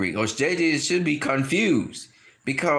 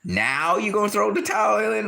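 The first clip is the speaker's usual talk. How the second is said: higher, disbelieving retort; incredulous question; 8 semitones higher than usual. Retold or imitated. Wilder questioning his coach, higher incredulous tone. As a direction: act Wilder baffled at the timing.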